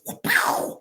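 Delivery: voiceless mock sound effect of a snap; unvoiced whoop and pow sound effect; muscle pop noise. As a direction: spit out the pop. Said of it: "that groin muscle goes".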